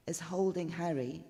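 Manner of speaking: usual speaking voice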